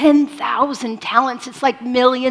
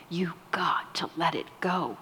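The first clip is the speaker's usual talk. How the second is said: whispered plea; 4 semitones lower, 9 dB quieter than usual. Real, not acted.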